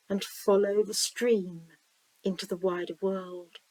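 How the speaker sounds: slow-paced visualization reading with long pauses; guided meditation. Acted. Reader guiding listeners down a stream to the sea, guided meditation. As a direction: lead the listener gently, leaving room to imagine.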